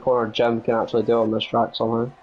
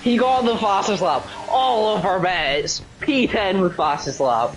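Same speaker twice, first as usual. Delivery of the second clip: high, thrilled race call; excited commentator; 8 semitones higher than usual. Real, not acted. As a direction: shout the fastest-lap news. Live race play-by-play.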